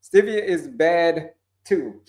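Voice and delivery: normal talking voice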